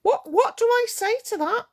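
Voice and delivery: can't believe what she's hearing; exasperated disbelief. Real, not acted. To her live viewers about Orion, high exasperated disbelief.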